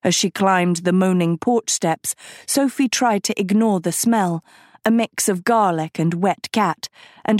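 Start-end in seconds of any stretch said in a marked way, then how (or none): none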